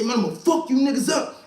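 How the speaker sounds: high angry yell at the snitches; furious threat. Retold or imitated. Yelling at the two informants in the cell, furious. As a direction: threaten them through the door.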